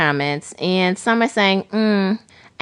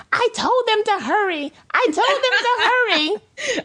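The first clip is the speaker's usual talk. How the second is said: much higher, whiny imitation of her crying; mock crying wife. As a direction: mimic a tearful new mother. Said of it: "like, crying".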